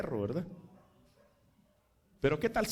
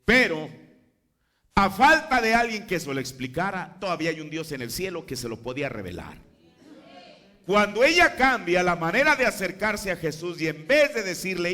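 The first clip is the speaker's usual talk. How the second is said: loud, higher fervent preaching; preaching at full force; 4 semitones higher, 7 dB louder than usual. Acted. Preacher stressing how God revealed it, loud fervent preaching. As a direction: build the point with fire.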